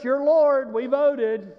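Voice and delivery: ordinary talk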